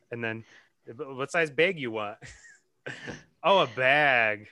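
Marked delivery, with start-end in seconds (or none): 0.9-2.2 "bag" repeated as "bayg", imitating the other speaker; regional BAG vowel. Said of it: "'cause they say bag". 3.4-4.5 "bag" drawn out slowly with a short-a vowel, louder; dawning recognition. Retold or imitated. Realizes what word was meant.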